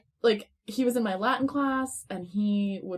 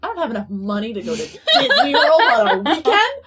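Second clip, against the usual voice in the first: loud, high protest breaking into laughter; mock-indignant; 5 semitones higher, 10 dB louder than usual. Teen turning down a Disney trip, mock-indignant. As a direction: retell it as an exasperated outburst, half laughing. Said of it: "I was, like, mad".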